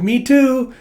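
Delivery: put-on high, bright-eyed little girl chiming in; chirpy kid. Girl chiming in after the boy, high and eager. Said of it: "It's like".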